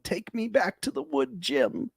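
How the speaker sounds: high, drawn-out mock plea; mock pleading Tolu. Cast member begging her man to take her back, high pleading mock voice. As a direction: whine it like a lovesick girl begging. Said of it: "Tolu's like".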